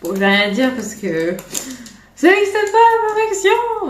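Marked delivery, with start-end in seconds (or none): 2.1-3.9 much higher, louder and slow, hammed-up; mock dramatic proclamation. To her viewers about uneven eyes, a hammed-up proclamation. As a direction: declare it like a grand truth, tongue in cheek. Mock grand declaration on perfection.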